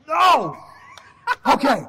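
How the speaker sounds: sudden loud shouted no. Retold or imitated. Wrestler shouting no at his boss in the story.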